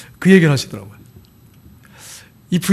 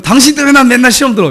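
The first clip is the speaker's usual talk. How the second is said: higher, louder resentful complaint; blaming spouse; 7 semitones higher, 5 dB louder than usual. Retold or imitated. Spouse blaming partner for their troubles, resentful. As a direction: throw the blame at your husband. Example of hurtful words to spouse.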